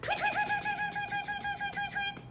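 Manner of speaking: very high, fading chirped tweets; panicked bird alarm. Retold or imitated. Chickadee panics as the owl swoops, very high fading tweets. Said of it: "this bird starts to panic".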